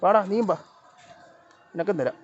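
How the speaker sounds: high, loud coaxing call; calling the cats. Real, not acted. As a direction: call them over to be fed. (പൂച്ചകളെ തീറ്റാൻ ഉറക്കെ വിളിക്കുന്നു)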